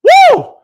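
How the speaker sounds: high, loud excited "woo"; loud whoop. Real, not acted. Speaker hyping his own point to listeners, loud whoop. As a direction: let out a piercing whoop.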